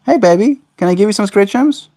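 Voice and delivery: high, lilting coaxing. Real, not acted. Owner offering bird scritches, high baby talk. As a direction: coax a pet in a high voice.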